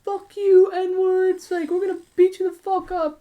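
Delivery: very high, loud mock screaming; imitated screaming. Kids yelling from their car, imitated screaming. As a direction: screech threats like someone yelling from afar. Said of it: "like, just screaming".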